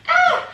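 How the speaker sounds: sharp high startled ah; startled yelp. Real, not acted. Realising she forgot her drink, startled yelp. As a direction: yelp as it suddenly hits you.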